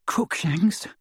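high, winded questioning gasp; wheezing. A narrator's reading. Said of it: "wheezed Hermione".

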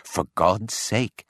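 slow, hushed, desperate plea; terrified plea. A narrator's reading. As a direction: beg in terror of being overheard. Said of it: "in a terror lest the Martian should hear us".

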